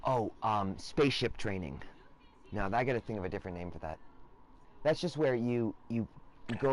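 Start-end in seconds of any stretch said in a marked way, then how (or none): none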